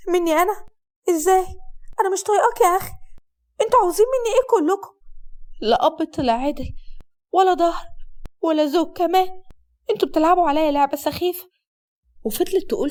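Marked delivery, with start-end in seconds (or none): 0.1-11.5 angry outburst. Acted. Wife exploding at her husband in the street, angry and higher. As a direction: lash out at all of them.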